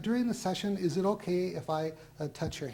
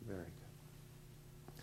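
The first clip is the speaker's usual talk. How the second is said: whispered; 9 semitones lower, 18 dB quieter, much slower than usual. Acted. Hypnotist praising subject in trance.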